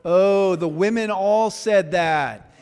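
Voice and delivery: ordinary talk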